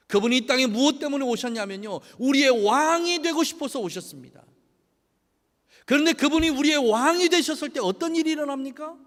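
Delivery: loud, high, impassioned sermon delivery; rising pulpit fervour; full-force preaching. Acted. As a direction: preach it out to the congregation. Preaching to congregation.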